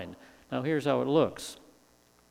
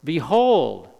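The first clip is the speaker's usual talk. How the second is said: loud ringing call for attention; shouted exclamation; 7 dB louder than usual. Acted. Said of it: "he shouts out a one-word exclamation".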